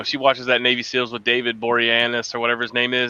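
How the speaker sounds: ordinary delivery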